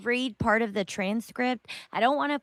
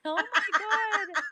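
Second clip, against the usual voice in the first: high, slow laughing exclamation; helpless laughter; 11 semitones higher, 5 dB quieter, slower than usual. Real, not acted. Reacting to the ranting woman, helpless laughter. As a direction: let real laughter carry the words up. Laughing at own mimicry and clip.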